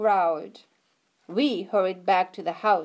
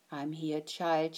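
softer and lower, steady comfort; calm the child on the trellis; grandma soothing, steady and soft; 5 semitones lower, 8 dB quieter than usual. Acted. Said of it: "Her voice was steady and soft".

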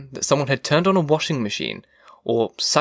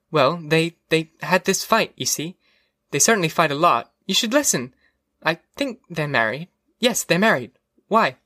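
higher-pitched, hesitant wife voice; Mildred, vague and defensive; 4 semitones higher than usual. Acted. Wife explains the screen fight to her husband, defensive.

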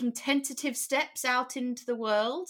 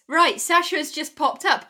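ordinary talk, nothing marked

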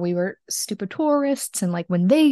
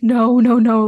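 retold emphatic protest; 2 semitones higher, 8 dB louder, slower than usual. Couple telling photographer to leave the dog, loud eager protest. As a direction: blurt it loudly, waving the objection away.

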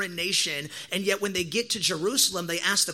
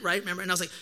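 ordinary talk, nothing marked

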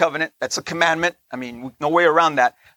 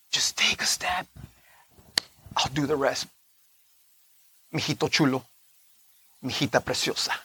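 tender voice of God; 4 semitones lower, 7 dB quieter than usual. Acted. God speaking tenderly to believers.

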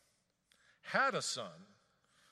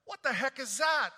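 high, loud alarmed sailor cry; panicked sailor; 10 semitones higher, 10 dB louder than usual. Acted. Sailor shouting in panic at the storm. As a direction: yell it like a sailor seeing a storm hit.

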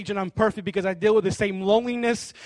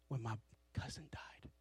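hushed grief; 8 semitones lower, 19 dB quieter than usual. Real, not acted. Grief-choked question to God.

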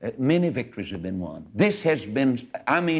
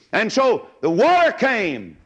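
high, slow, drawn-out preacher's cry; dramatic climax; 9 semitones higher, 4 dB louder, slower than usual. Real, not acted. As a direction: swell the voice as the waters crash in. Dramatic climax of Red Sea story.

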